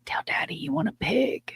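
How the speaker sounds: high, soft wheedling; coaxing the baby. Retold or imitated. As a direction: lure a little one with a high, quiet lilt. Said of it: "lure the baby in and be like".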